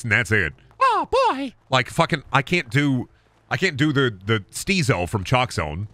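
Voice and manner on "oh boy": very high falsetto cartoon mouse; Mickey Mouse voice. Shown as a demonstration. Showing his only cartoon voice, a Mickey falsetto. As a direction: chirp it like Mickey. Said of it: "I can do, like, a Mickey Mouse voice".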